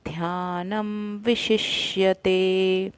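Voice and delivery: "ध्यानम विशिष्यते" very slowly, short i; slow recitation. Shown as a demonstration. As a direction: draw out "विशिष्यते" syllable by syllable. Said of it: "श में छोटी की मात्रा".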